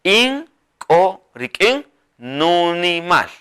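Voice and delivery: each word stretched; slow dictation. Shown as a demonstration. Teacher models the sentence slowly for learners. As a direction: lay out the phrase slowly. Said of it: "Así es como".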